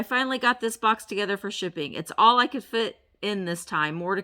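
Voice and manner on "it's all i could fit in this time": usual speaking voice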